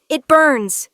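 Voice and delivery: loud high cry of pain; pained scream. A narrator's reading. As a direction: cry out in agony. Dev burning.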